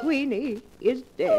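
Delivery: choked announcement of a death; grief-stricken. Acted. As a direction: voice breaking as she says the cat is dead. The pet cat has just died.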